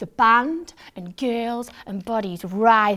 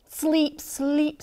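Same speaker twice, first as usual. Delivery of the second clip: pleading; 6 semitones higher than usual. A narrator's reading. Craving sleep, pleading.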